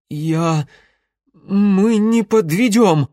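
halting start, then high, loud promise; stammer, then promise fervently; undignified blurt. A narrator's reading. Alyoshka promises the being, all dignity lost. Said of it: "полностью потеряв всякое достоинство, выдохнул Алёшка".

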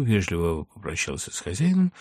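plain reading voice

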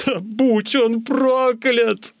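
high, stretched-out howl; anguished high yell; wailing curse. A narrator's reading. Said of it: "завопил я".

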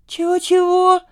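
put-on very high-pitched, taken-aback girl; startled indignation. A narrator's reading. Romashka catching herself at Kostya's teasing, startled. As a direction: jump up high as if caught off guard. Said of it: "спохватилась Ромашка".

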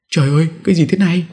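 high, fast shriek without much volume; startled cry. A narrator's reading. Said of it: "Bà Thủy lùi lại hét lên". (tiếng kêu hoảng hốt, cao và nhanh)

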